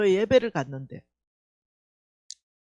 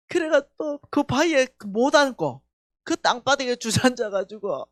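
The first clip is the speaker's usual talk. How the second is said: normal talking voice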